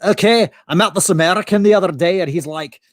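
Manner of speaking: storytelling in a put-on Scottish accent; Scottish brogue. Fake Scot recounts meeting an American, Scottish brogue. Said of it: "I'm doing the accent".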